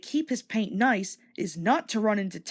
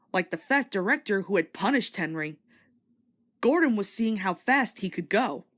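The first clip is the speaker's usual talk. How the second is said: usual speaking voice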